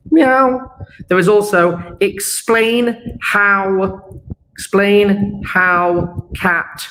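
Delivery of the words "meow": high, loud playful meow; cat meow. Pretend. Teacher meows like a cat for the cat pun.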